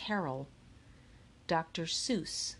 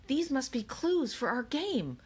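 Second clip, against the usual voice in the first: blurted; 7 semitones higher than usual. A narrator's reading. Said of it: "blurted Akemi".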